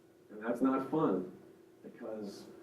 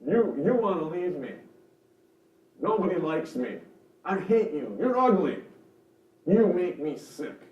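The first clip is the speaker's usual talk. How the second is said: put-on higher, defensive child complaining; emotional, accusing whine, pitched up; whiny child voice; 5 semitones higher, 6 dB louder than usual. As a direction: play a victimized kid lashing out. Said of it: "kind of whiny".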